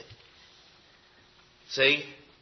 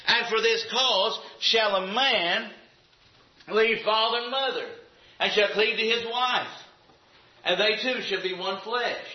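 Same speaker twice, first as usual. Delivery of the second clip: scripture reading; 4 semitones higher than usual. Acted. Preacher reading Genesis aloud to the congregation, solemn. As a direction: intone the verse with weight.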